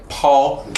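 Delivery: "Paul" held on one high, flat first tone; Mandarin first tone. Shown as a demonstration. Teacher showing viewers Mandarin first tone on "Paul". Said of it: "flat tone".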